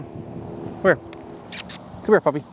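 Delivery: high, hushed coaxing; coaxing the dog. Real, not acted. Owner coaxes the puppy to come here, high hushed coaxing. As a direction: coax the puppy in softly.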